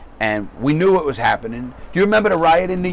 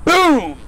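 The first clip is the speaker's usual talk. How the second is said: a loud, high, punchy boom for paper hitting a desk; mock slam boom; 13 semitones higher, 5 dB louder than usual. Telling a friend how the judge slammed the paperwork, loud mock boom. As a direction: slap the word out like a stack of paper landing.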